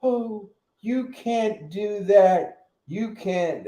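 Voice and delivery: slow high mock whine of the easily offended; mocking soft whiner. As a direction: mimic the oversensitive crowd. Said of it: "They're soft".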